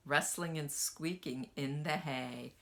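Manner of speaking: plain reading voice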